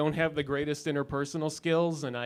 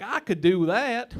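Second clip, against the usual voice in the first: a put-on Southern accent, slowed down and a bit higher; 7 semitones higher, 5 dB louder, slower than usual. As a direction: imitate a Southern guy watching TV. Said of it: "It's my southern accent".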